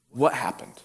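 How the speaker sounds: ordinary delivery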